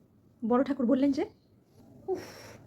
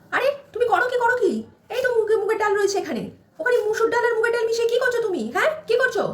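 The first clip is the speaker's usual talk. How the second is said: raised, higher scolding mother-in-law; 7 semitones higher, 4 dB louder than usual. Acted. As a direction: shout at the bride over the dal.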